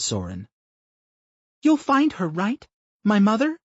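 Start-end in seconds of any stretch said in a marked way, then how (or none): 1.6-3.6 put-on high little girl asking; a young daughter, hopeful; child's voice. A narrator's reading. Young daughter asks narrator's hero, small hopeful child voice. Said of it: "she asked".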